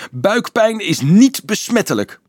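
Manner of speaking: high, loud pleading protest; begging. A narrator's reading. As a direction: insist desperately. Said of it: "smeekte hij".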